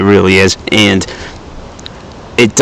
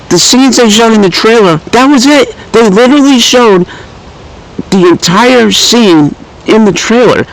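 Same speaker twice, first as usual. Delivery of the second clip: indignant outburst; exasperated rant; 11 semitones higher than usual. Real, not acted. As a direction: vent in disbelief at the trailer. Ranting about the trailer.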